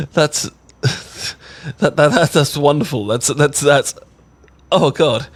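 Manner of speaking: breathless happy stammering; flustered delight. Acted. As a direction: stammer in overjoyed disbelief. Flustered joy after confession.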